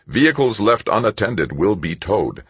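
even, synthesized machine warning; a lightly voiced police robot. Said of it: "said the robot's synthesized voice".

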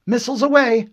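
high, loud call-out; officer's launch call. Acted. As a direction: announce the launch to the bridge. Officer calls out missile launch.

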